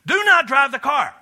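put-on a fed-up parent laying down the law to a teen; loud scolding parent; stern parent warning. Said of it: "You said".

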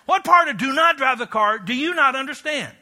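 put-on a fed-up parent berating a child who didn't listen; loud disbelieving rebuke; exasperated parent. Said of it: "You said".

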